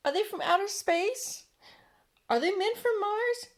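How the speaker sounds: high, loud excited cry; crowd shouting. A narrator's reading. Crowd below shouting up at the peach, high and loud. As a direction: call up to the sky in wonder. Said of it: "they shouted".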